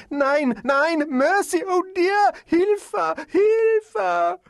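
very high, drawn-out wails for help; agonized cry. A narrator's reading. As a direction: wail for mercy in a piercing falsetto. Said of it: "An agonized cry".

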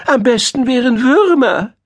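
high thin little mole voice; thin-voiced mole. A narrator's reading. Mole argues for his favourite food, thin high voice. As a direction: squeak it insistently as a tiny mole. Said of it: "mit seiner dünnen Stimme".

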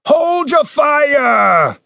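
high, drawn-out shouted order; commander's shout. A narrator's reading. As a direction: call it out slowly from outside. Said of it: "came a sound from outside".

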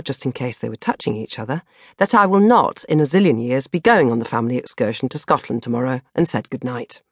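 ordinary delivery

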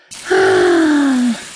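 long, high sigh of relief; relieved sigh. Acted. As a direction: let out a big relieved sigh. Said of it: "Sighed the witch with relief".

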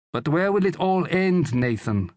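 high, anxious woman's voice; worried wife. A narrator's reading. Wife asking husband about the war, worried. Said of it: "asked Juliana worriedly".

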